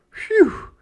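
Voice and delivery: huge high phew of relief; relieved phew. Acted. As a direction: blow out relief. Relief after searching.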